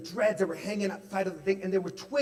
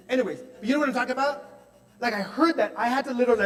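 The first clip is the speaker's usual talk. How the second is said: quick, higher rushed aside; 6 semitones higher, 5 dB louder, faster than usual. Real, not acted. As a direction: rattle it off fast.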